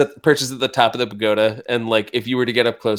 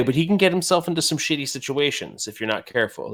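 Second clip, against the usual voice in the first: ordinary talk, nothing marked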